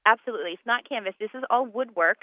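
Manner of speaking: ordinary talk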